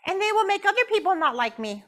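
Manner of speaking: squeaky high puppet hello, loud; puppet Jasmine greeting. Acted. Puppet Jasmine greets the audience in a high squeaky voice. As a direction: pop up and chirp hi.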